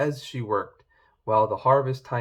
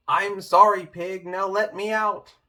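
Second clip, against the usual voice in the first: louder and higher, shouting the sorry; shouted apology; 6 semitones higher, 6 dB louder than usual. Acted. Wolf in the pot shouts his apology to Pig as ordered. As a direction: call it out loud for the pig. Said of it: "give a shout".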